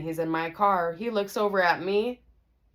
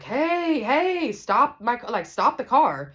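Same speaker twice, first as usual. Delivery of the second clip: raised, high shout to flag down a driver; calling out; 7 semitones higher, 5 dB louder, slower than usual. Retold or imitated. Waving down friend's boyfriend in his car, calling out. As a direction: holler it, retold at moderate volume.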